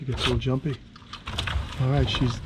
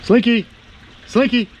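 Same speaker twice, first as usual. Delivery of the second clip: calling out; 7 semitones higher, 5 dB louder than usual. Real, not acted. Calling the lizard by name.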